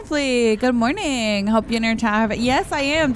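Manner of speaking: usual speaking voice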